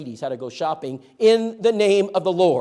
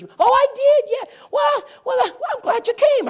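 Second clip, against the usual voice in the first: very high, loud falsetto lady voice; woman at the door; 10 dB louder than usual. Retold or imitated. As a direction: squeal it out like the excited young wife. Woman at door answering preacher.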